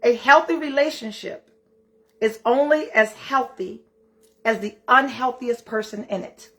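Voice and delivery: slow and deliberate. Shown as a demonstration. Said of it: "I gotta say this slowly".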